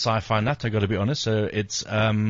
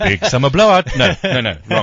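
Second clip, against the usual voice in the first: loud laughing protest; 5 semitones higher, 9 dB louder than usual. Real, not acted. Laughing at Olaf double meaning.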